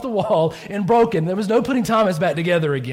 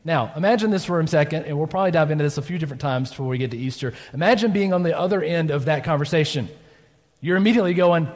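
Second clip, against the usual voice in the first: ordinary delivery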